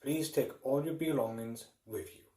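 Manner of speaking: ordinary talk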